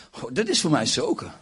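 ordinary delivery

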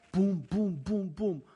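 knocking sounds. Acted. Imitating knocking on door.

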